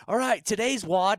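high, loud mock coach call; coach's announcement. Coach announcing today's wad to class, mock loud call.

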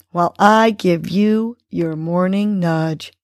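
normal talking voice

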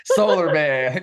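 slow, high booming hero title; superhero announcer. Pretend. Playful superhero title riff.

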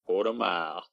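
low, soft Australian take on American; imitated American accent. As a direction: mimic Aussies faking an American accent. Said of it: "wanted to do an American accent".

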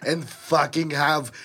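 mock-menacing Austrian impression; action-hero threat with a thick accent; Arnold. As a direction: threaten like a cartoonish Terminator. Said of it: "podcast where Arnold is".

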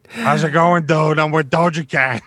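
lightly voiced goofy Frankie; a hint of the goofy character voice. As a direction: greet them as Frankie. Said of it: "what inspired Frank's voice".

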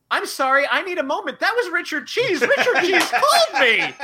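high, loud, laughing disbelief; giddy and laughing at the news; starstruck. Retold or imitated. Fan telling coworkers who just called, laughing and starstruck. Retold being starstruck after call.

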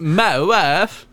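high, loud Borat catchphrase, rising; Borat impression. Co-host jumps in with a high, loud Borat catchphrase. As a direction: shout it like Borat. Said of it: "A little bit Borat-y".

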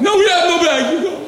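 high, loud mock vendor shout. Vendor refusing the bag, loud mock yell.

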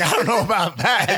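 high, loud laughing line; quoting Kid. Retold or imitated. Friend reacting in the Jeep, high laughing doubt. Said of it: "he's like".

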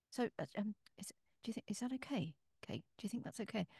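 unvoiced anxious whisper. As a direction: lean in and whisper nervously. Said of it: "he turned to me and he said".

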